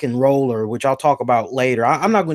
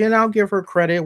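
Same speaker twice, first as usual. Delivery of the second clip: normal talking voice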